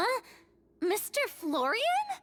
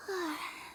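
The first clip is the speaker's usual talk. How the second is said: a soft sigh of relief, letting go; relieved sigh; 4 dB quieter than usual. Real, not acted. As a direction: breathe out the tension. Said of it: "Then I can probably relax".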